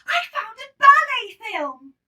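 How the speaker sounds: loud high thrilled cry; child showing off her find; excited shout. A narrator's reading. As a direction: blurt it out with joy. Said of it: "I called out with excitement".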